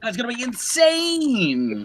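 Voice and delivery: high, slow hyped-up line; hyped drawl. Acted. Hyping the next guest to the audience, high and drawn out. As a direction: stretch it out with mock hype.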